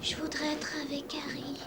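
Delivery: murmure d'enfant sans voix; dis-le dans un souffle; chuchoté. Acted. Enfant au lit la nuit.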